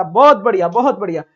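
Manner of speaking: a loud, quick burst of praise. Real, not acted. Teacher loudly praising a student's right answer.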